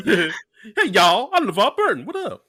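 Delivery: high, loud, laughing greeting; hammed-up impersonation. Co-host mocking a lazy LeVar Burton impression, hammed-up. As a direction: call out a high goofy hello.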